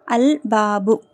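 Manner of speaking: slow "அல் பாபு" with the L sounded; "அல் பாபு" drawn out, clear L; lam pronounced. Shown as a demonstration. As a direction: model "அல் பாபு" slowly, keeping the L. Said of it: "கமரியாவுடைய எழுத்து".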